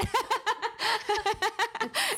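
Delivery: a long high burst of laughter. Real, not acted. Laughing after telling how she expected to die, high and long. Laughing at own dark story.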